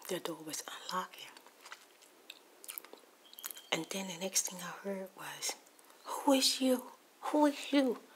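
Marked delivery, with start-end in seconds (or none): 6.0-8.0 higher, louder accusing questions; retold angry confrontation. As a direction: demand answers, voice pitched up. Retold angry confrontation over intruder.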